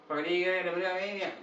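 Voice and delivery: high, slurred bogan Australian; bogan Aussie accent. Acted. Mocking bogans he can't understand, in garbled high Aussie.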